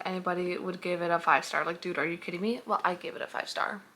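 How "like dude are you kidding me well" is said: ordinary talk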